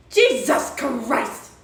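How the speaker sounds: loud, slow, stretched-out outburst; real fed-up yell; exasperated shout. Real, not acted. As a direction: blast it out in disbelief. Exasperated at chat misreading her.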